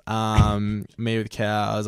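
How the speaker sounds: ordinary delivery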